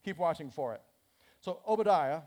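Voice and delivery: ordinary talk, nothing marked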